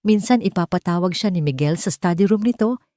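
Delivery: usual speaking voice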